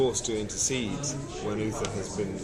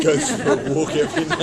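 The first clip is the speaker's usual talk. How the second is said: much higher and louder, playful; amused quip; 10 dB louder than usual. Real, not acted. Actor joking to interviewer about the king, higher and louder playful quip. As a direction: toss it off as a cheeky joke.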